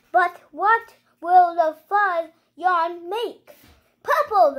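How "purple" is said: high loud shout of the colour; excited reveal. Real, not acted. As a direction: announce it like a big surprise. Kid revealing the yarn colour.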